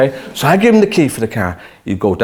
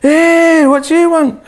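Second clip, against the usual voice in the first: loud, much higher barked greeting; trainer's gruff shout; 7 dB louder, slower than usual. Retold or imitated. Trainer yelling at a newcomer walking into his gym. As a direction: bark it out like a tough gym trainer. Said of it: "This trainer stood there and he went".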